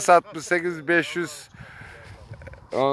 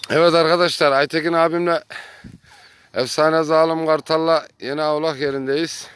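ordinary talk

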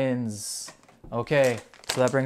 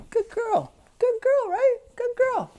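very high sing-song praise; squeaky high praise for the good puppy; dog praise voice. Real, not acted.

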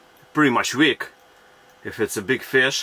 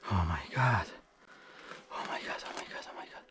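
breathless whispered oh my God; awed whisper; 4 semitones lower, 13 dB quieter, faster than usual. Real, not acted. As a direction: hush it in disbelief. Fish biting, hushed awe.

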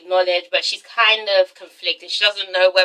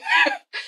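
sudden squealing laugh, very high; a squealing laugh at the dull dance; 4 dB quieter than usual. Real, not acted.

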